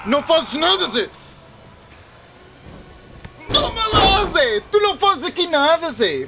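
high, loud mocking jeer at Zé; gloating taunt. Gamer taunting his wrestling opponent Zé, loud high jeer. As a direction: rub it in his face.

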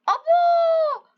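high, loud amazed cry; amazed shout. Real, not acted. Gamer amazed at the goat's stunt, high shout. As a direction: shout out in wonder.

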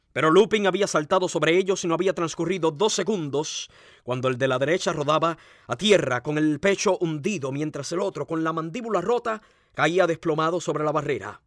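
usual speaking voice

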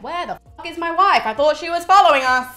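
acted-out confused indignation; bewildered demand. Aeneas looks around for his missing wife, bewildered.

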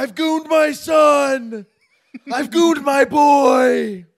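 high, slow furious yell. Acted. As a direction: roar it at your wife. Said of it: "Jake Gyllenhaal ... yelling at his wife".

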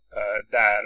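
usual speaking voice

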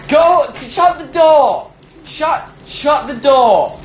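high, drawn-out command; slow, high-pitched exasperated call; exasperated order. Real, not acted. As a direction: order them out, pitch soaring then falling. Ordering someone to shut the door.

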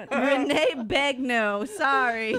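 loud, choked with laughter; laughing apology. Real, not acted. Host retrying a botched name, laughing loudly through it.

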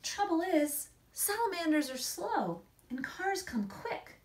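plain reading voice

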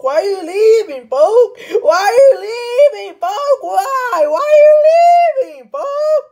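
hammed-up tearful wail, high and loud; fake-sob the question like a heartbroken kid; mock crying cry to the departing year. Mock wailing at year ending.

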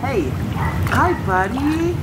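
high, slow cooing greeting. Real, not acted. Greeting the baby.

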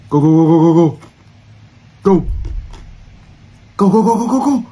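loud rapid urging; urgent. Real, not acted. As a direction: chant it urgently. Urging escape.

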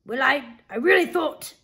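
usual speaking voice

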